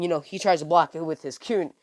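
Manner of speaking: ordinary delivery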